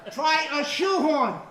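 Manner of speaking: loud, slow heckling shout; slow mock heckle. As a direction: drag out the insult loudly at her. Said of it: "And I'm going".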